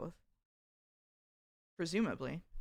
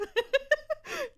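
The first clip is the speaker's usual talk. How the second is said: high laugh; 4 dB louder than usual. Real, not acted. Laughing at chat message.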